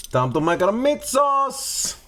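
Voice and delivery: lightly hammed-up Italian chef voice, high; a hint of a singing Italian cook; Italian accent. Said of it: "I don't know why I'm going Italian".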